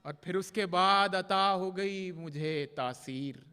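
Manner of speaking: measured, projected mushaira verse with drawn-out line endings; poetry recitation. Acted. Poet reciting love couplets to a mushaira audience, declaimed Urdu verse. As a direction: recite the ghazal line by line, pausing between phrases.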